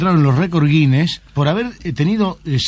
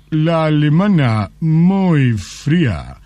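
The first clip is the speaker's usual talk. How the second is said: very slow, savouring verdict; pause, then drag out the praise; slow Japanese man; much slower than usual. Acted. Japanese lover judging women for the interviewer, very slow.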